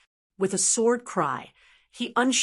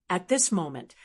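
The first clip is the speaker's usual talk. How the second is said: plain reading voice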